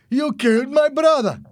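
high, loud, enraged shout. A narrator's reading. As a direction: yell it out as an accusation. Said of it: "Roberto shouted".

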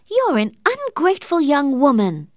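high, loud scolding shout. A narrator's reading. The aunt scolding Catherine as ungrateful, shouting. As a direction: scold her niece at full voice. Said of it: "Missus Penniman shouted back at Catherine".

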